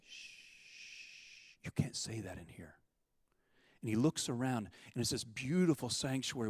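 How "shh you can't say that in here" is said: urgent whispered shush; hushed whisper. Retold or imitated. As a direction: hush him, all breath, no voice. Woman hushes him in sanctuary.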